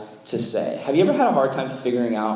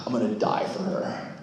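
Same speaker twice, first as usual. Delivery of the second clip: low, breathy, overdramatic movie line; boy jokingly vows to die for a girl he barely knows, mock heroic; 3 semitones lower than usual. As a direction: ham up a movie hero's vow. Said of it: "And you're like".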